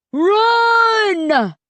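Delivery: high, loud shout of warning; urgent scream. A narrator's reading. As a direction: scream it as you shove him away. Styx shoves narrator, danger near.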